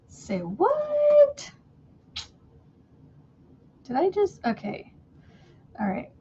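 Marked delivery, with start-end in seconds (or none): 0.1-4.9 high, surprised mom voice. Retold or imitated. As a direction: squeak it like a startled mom. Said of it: "Your mom's like".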